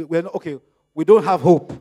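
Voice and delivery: ordinary talk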